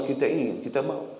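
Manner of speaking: ordinary talk, nothing marked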